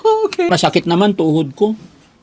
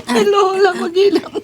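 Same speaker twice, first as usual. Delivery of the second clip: being tickled; 12 semitones higher than usual. Real, not acted. Ticklish during massage, high cry.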